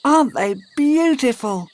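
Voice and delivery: put-on high, puzzled little girl; a child asking in a high voice; high girl's voice. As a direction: squeak it out puzzled. May voiced.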